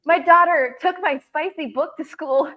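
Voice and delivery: high and loud, building mock dismay; horrified friend. Friend explains the school incident, imitating her horror. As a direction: tell it like a mortified mom. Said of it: "And she goes".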